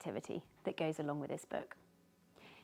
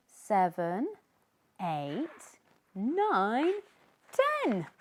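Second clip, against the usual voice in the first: building count; 4 dB louder, much slower than usual. Acted. Sneezy counting at the surface, building excitement.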